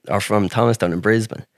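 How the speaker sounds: normal talking voice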